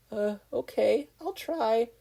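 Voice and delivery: high, timid squeak; nervous squeak. A narrator's reading. Izuku answering Ochako's fire, nervously squeaking. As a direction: squeak it out, nervous but eager. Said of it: "Izuku nervously squeaked out".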